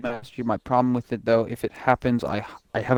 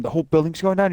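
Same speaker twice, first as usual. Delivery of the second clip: normal talking voice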